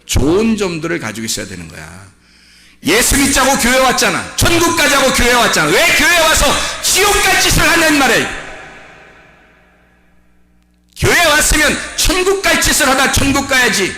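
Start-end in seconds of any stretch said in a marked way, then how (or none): 2.8-13.9 shouted sermon, pitched very high; full-force preaching. Acted. (고음으로 부르짖는 설교)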